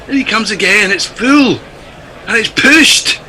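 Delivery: put-on Scottish commentator voice; mock Scottish play-by-play; Scottish accent, calling the ball. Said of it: "in this accent".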